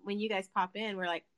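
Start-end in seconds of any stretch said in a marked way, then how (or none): none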